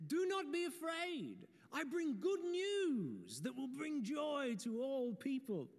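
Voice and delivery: high, slow, booming proclamation; grand angel voice. Acted. Pastor playing the angel to shepherds, high and grand. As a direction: announce it like the angel Gabriel in a show. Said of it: "I don't know why an angel talks like that".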